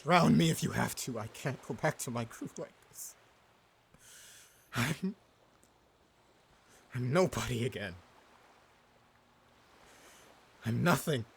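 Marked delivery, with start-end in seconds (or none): 1.2-11.2 soft, low, hopeless murmur; broken despair. Acted. Disgraced captain quietly mourning who he was. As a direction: let the words sink into defeat. Defeated, self-pitying.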